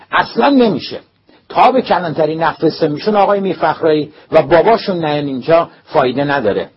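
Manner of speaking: very high, loud, furious refusal; angry refusal. A narrator's reading. Principal flatly refusing the teacher's plea, furious shout. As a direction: shut the idea down in a rage.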